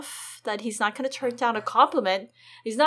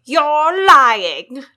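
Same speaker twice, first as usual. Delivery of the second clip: angry accusation; 4 semitones higher, 13 dB louder than usual. Acted. Joffrey accuses Sansa.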